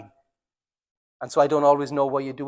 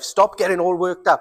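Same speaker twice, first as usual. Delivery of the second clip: louder, higher telling-off; lightly acted nag, pushed up in pitch and volume; mock spousal scolding; 4 semitones higher, 5 dB louder than usual. Preacher voicing what never to tell your spouse, mock scolding.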